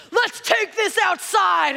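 slow, loud, high yell; screamed back. Acted. Norm answering the screaming man, screaming back. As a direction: yell the challenge right back. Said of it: "So I screamed back".

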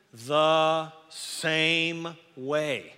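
very slow, sung-out "same way"; drawn out. Shown as a demonstration. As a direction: stretch "the same way" like a chant. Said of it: "drawn-out scripture phrase".

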